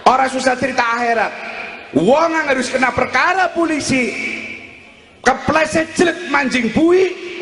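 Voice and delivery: high-pitched, forceful sermon delivery; preaching. Real, not acted. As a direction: drive each short phrase home like a preacher.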